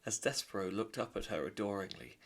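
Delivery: ordinary delivery, nothing marked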